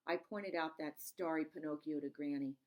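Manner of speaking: ordinary delivery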